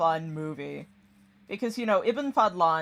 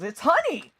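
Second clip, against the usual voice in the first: high, loud laughing shout; gleeful reveal; 11 semitones higher, 7 dB louder than usual. Retold or imitated. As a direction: blurt it out, delighted at the joke. Friend laughing, reveals the joke.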